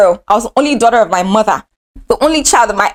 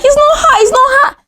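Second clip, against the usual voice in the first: put-on girls crying out high, it's not her; pleading shout in a high voice. Girls defending her to the senior, high pleading shout. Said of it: "Even the girls were like".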